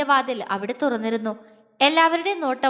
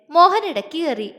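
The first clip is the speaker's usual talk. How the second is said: ordinary delivery, nothing marked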